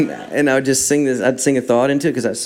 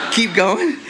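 laughing; 9 semitones higher than usual. Real, not acted. Laughing while telling.

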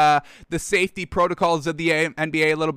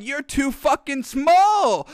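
retold yell; 8 semitones higher, slower than usual. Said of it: "Luka Doncic yells out".

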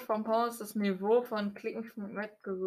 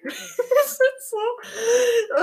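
laughing; 13 semitones higher, 5 dB louder, much slower than usual. Real, not acted. Laughing at chat message.

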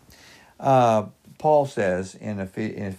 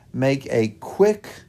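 quick drawn out and held, pitch lifted; slow down and lean hard on the word quick; stress on quick; 3 semitones higher, slower than usual. Real, not acted. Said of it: "I emphasize the word quick".

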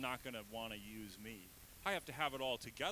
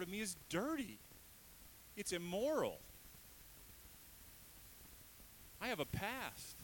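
high, urgent, pleading voice; ashamed believer pleading with God, high and strained; anguished plea; 8 semitones higher than usual. Acted. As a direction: beg God to see the shame. Voicing himself pleading with God.

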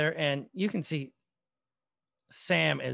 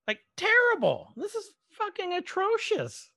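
real disgust bursting out high and loud; outraged; 4 dB louder than usual. Real, not acted. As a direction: pitch jumps way up, loud on terrible, real outrage. Outraged at syphilis experiments.

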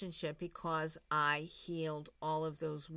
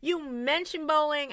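mock indignant; 8 semitones higher, 5 dB louder than usual. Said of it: "I got to the point where".

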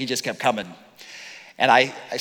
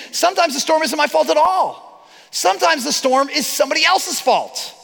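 preaching; 8 semitones higher than usual. Acted. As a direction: preach it out to the congregation. Preaching climax.

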